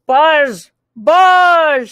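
buzzing cow. Acted. The cow with a cold buzzes, loud and high. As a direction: buzz loudly as the sick cow. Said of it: "said the cow".

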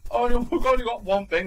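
loud, fed-up moan; exasperated groan. Retold or imitated. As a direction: moan it like a stuck shopper. Repeats lament louder.

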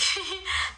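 a soft high giggle. Real, not acted. Streamer giggles at a viewer's compliment. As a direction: giggle shyly.

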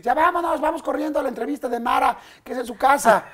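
high, loud hurried calling; rushed call. Retold or imitated. Crew rushing the host to an interview, loud high calling. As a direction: hustle him out the door, loud and high.

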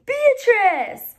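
high, loud emotional cry of the name; cried out. A narrator's reading. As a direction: cry out to your dog. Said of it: "Brad cried".

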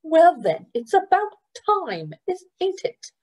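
high, sharp foster mother; Trotter's retort. Acted. Trotter needling Gilly about touching him, sassy. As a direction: needle her, a sassy comeback.

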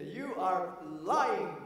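very slow, each word weighed; slow emphatic accusation. Retold or imitated. Grandfather slowly tells grandson he is lying. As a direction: spell out the lie word by word.

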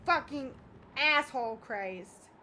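slow, drawn-out frustrated cursing; real anger, slow and emphatic; so mad. Real, not acted. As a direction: drag the words out in exasperation. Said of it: "I'm so mad".

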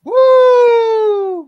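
high cheering whoop; excited whoop. Real, not acted. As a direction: whoop in celebration. Celebrating the run.

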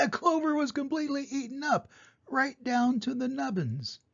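high-pitched, aghast storytelling; gasp, then tell it in horrified disbelief; high, dismayed narration; shocked. A narrator's reading. Narrator voices shock at eaten clover.

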